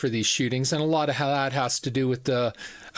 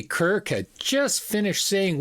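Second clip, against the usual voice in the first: ordinary delivery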